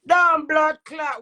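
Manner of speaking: heated cursing; angry curse. Real, not acted. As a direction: spit the curse at him. Cursing the critic.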